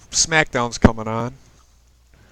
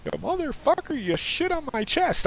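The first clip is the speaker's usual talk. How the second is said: high mock screech, hammed up; mock outrage. Joking about future quarrels with her partner, high mock screech. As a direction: play a silly furious squawk as a joke. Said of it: "I'm just kidding".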